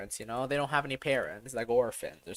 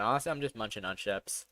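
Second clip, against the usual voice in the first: ordinary talk, nothing marked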